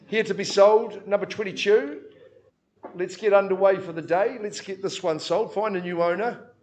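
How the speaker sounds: loud, rhythmic auctioneer calling bids; auction bid spotter. Acted. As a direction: drive the room, call each bid out.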